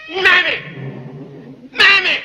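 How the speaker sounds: loud, impatient calling out; angry calling. Acted. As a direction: yell across the house for her. Master calling the servant.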